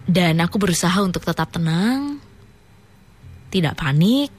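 slow, spooky story reading; story narration. Acted. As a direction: read it like a ghost tale. Reads listener's horror story.

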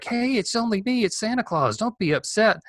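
high, sing-out mock coaxing; imitated teenage hacker. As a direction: lightly imitate a teen posing as Santa. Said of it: "who sounded like a teenager".

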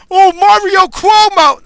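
high, loud, drawn-out mocking wail; mock adoration. Host mocking those who cite the politician, exaggerated high wail. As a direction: stretch the name out in mock awe.

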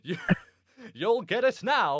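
mock a corny supervillain gloating at Batman; Batman villain. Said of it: "it sounds like a Batman villain line".

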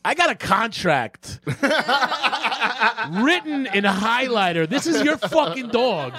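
high, slow mock rant; mock rant to girlfriend, high.

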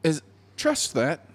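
very high, insistent appeal; acted plea straining high in pitch; impassioned pleading. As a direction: build to an exasperated, heartfelt peak. Voices God's climactic appeal to Adam.

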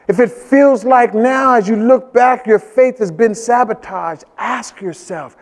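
loud, slow, driving sermon; preaching. Acted. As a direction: bear down and preach it. Preaching at full force.

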